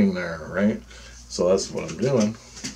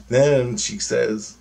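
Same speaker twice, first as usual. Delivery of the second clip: usual speaking voice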